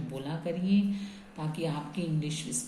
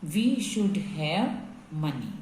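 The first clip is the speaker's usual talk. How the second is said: slow dictation; 2 semitones lower, much slower than usual. Shown as a demonstration. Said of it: "तो इस sentence को हम कैसे बोलेंगे?".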